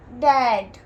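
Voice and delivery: डैड said loud and firm; emphatic answer. Shown as a demonstration. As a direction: give the answer डैड with a clear loud beat. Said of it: "D A D क्या हो गया?". (डैड ज़ोर देकर बोलना)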